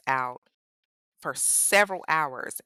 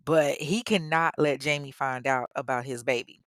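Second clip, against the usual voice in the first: ordinary delivery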